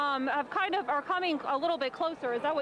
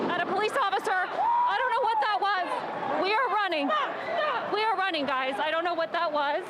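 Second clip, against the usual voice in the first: startled; 7 semitones higher, 4 dB louder than usual. Real, not acted. Startled reporter after loud noise.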